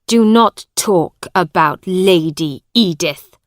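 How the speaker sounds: loud angry warning. A narrator's reading. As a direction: snap it at him with anger. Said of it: "said Hugh angrily".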